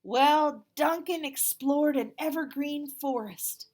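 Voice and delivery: put-on picture book read to children; storybook narration. A narrator's reading. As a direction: tell it to the kids with big energy. Reads the picture book aloud.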